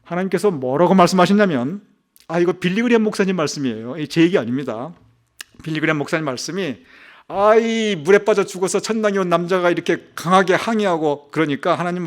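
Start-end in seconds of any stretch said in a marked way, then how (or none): none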